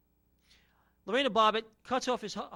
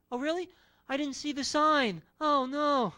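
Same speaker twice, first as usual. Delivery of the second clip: playing dumb; 6 semitones higher, 4 dB louder, slower than usual. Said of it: "They turn it to [name]".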